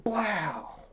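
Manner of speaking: a sharp vocal "Blow!" for a shot; a quick mock bang; 1 gunshot noise. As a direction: fire the gun with your mouth. Imitating the gunshot.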